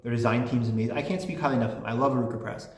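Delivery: normal talking voice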